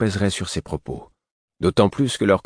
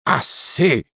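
high, sharp call for order; exasperated parental reprimand; scolding children; 9 semitones higher than usual. A narrator's reading. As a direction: cut off squabbling children. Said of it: "comme s'il s'adressait à des enfants".

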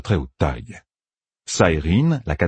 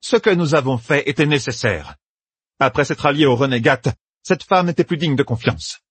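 raised, imperious justification; 8 semitones higher than usual. Acted. Saérine justifying to Egwene, imperious. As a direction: brook no argument. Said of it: "d'un ton plus impérieux".

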